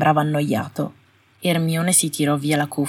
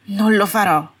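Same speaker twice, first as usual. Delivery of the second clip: sharp, quivering refusal, louder; cutting, trembling; 4 semitones higher, 6 dB louder than usual. Acted. Astoria refusing her husband, cutting and trembling. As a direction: bite it off, voice shaking. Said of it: "la voce tagliente e vibrante".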